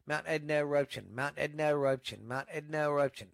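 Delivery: quick repeated tongue twister; tongue twister, three times fast. Shown as a demonstration. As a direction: rattle it off quickly. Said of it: "say that three times fast".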